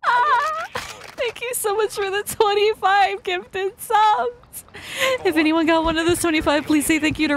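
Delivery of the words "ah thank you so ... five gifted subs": tearful thanks; emotional crying. Real, not acted. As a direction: voice breaking with emotion. Overwhelmed by gifted subs.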